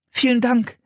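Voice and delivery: put-on high child's voice; high, sweet little thanks; grateful girl. A narrator's reading. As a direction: thank him politely. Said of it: "sagte Anne".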